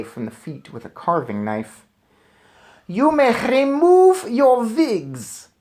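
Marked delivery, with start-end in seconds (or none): 2.9-5.5 put-on high, very slow, loud snarl with a guttural foreign accent; Grand High Witch snarling. A narrator's reading. Witch leader snarling a command at the room. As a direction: snarl and roll the R like a hag. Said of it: "snarled the Grand High Witch".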